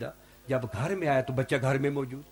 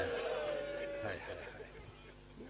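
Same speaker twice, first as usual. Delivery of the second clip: grieving sigh; 6 semitones lower, 15 dB quieter, much slower than usual. Real, not acted. Preacher laments during the majlis.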